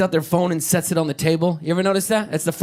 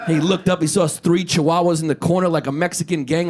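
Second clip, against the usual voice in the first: usual speaking voice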